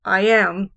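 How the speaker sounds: "I am" with a light "I" and a loud "am"; iambic stress. Shown as a demonstration. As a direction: punch "am" after "I". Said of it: "where it's the unstressed".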